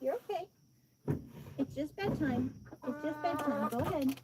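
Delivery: hushed, high coaxing to hens; soft coaxing. Real, not acted. Owner softly coaxing hens to roost, hushed and high. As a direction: gently send them in to bed.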